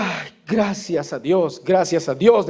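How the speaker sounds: soft, slow, tearful thanks; quietly weeping with relief; crying. Retold or imitated. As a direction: retell a sobbing man's thanks, softly. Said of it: "empezó a llorar con todo".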